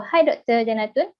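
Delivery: ordinary talk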